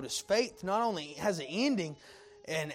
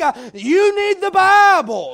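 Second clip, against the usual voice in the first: louder and higher, punching BIBLE; loud pulpit cry; 9 semitones higher, 14 dB louder, slower than usual. Acted. Preacher crying out to the congregation, loud. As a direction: shout it out to the pews. Preacher drives the point home.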